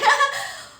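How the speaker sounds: a high, loud teary laugh; laughing through real tears. Real, not acted. Emotional laugh while tearing up.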